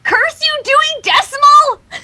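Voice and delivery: high, loud furious cry; angry shout. Acted. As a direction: curse him with a shriek of rage. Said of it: "shook her hoof angrily".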